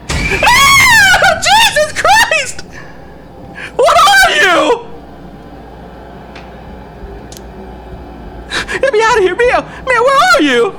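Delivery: panicked screaming. Real, not acted. Horror game scare, lights out.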